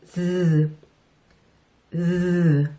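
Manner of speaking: Z said low and buzzing, with the tongue vibrating. Shown as a demonstration. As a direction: voice the S into a Z buzz. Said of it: "연습을 한번 해볼게요".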